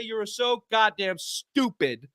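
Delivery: slow, scornful comeback; slow contemptuous put-down; scornful retort. Retold or imitated. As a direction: spell it out slowly with contempt. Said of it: "And to that I say".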